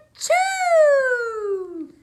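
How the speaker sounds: huge loud high sneeze; big sneeze. Acted. Bear sneezes from pepper in the stew, loud sneeze. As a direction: let the sneeze explode out.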